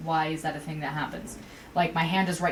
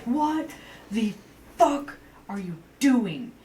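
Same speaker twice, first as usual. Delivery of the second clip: a high, sharp burst of anger that slows down at the end; imitating yourself snapping at a customer, half-shouting; retold angry outburst; 4 semitones higher, 3 dB louder, slower than usual. Worker retelling how she yelled at a customer, mock angry. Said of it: "And I went off".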